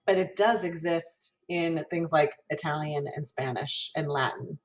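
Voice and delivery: ordinary talk, nothing marked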